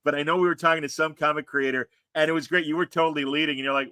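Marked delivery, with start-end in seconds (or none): none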